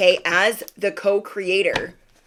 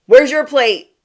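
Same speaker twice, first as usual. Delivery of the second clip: much louder, higher, startled question; sudden realization; 5 semitones higher, 9 dB louder than usual. Pretend. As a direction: catch yourself with no plate. Host suddenly notices own empty plate.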